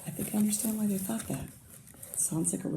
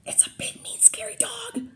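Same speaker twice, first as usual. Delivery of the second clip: frightened whisper; 9 dB quieter than usual. Acted. Burglar spotting the dog, whispering scared. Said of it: "whispered one burglar".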